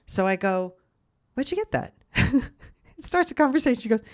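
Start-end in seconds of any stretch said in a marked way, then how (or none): 1.3-1.9 surprised question. Retold or imitated. Retelling shocked question to mom. 2.1-2.6 a quick burst of real laughter; short laugh. Real, not acted. Laughs after retold question.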